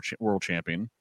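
ordinary talk

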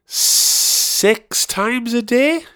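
high, very drawn-out strained words; agonised high squeal. Real, not acted. Comic haunted by his gig blunder, high agonised cringe. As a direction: squeeze it out slowly, high with cringe.

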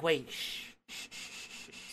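drawn-out breathy shushes; imitated hushing. Wrestler shushing the ref's knockout count, imitated hushing. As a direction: hush the room, playing the wrestler. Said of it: "Sadie Lee Moss is like".

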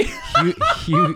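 talking through a real laugh. Real, not acted. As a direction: start high in laughter, then slow down. Cracking up at crude joke.

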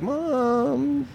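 put-on high, pleading child; whiny kid voice. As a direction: whine to mom like a kid. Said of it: "I was just like".

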